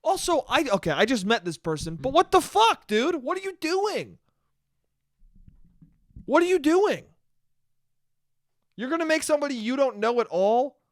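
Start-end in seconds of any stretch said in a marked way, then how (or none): 2.0-4.2 much higher, exasperated outburst; heated disbelief. Real, not acted. Ranting to listeners about a friend, in high heated disbelief. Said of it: "I'm getting fucking heated". 6.2-10.3 "What are you doing?" much higher and pushed, quick and sharp; heated disbelief. Real, not acted. Ranting about the friend who dodged the fine, asking "What are you doing?" sharply in outrage. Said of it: "I'm getting fucking heated".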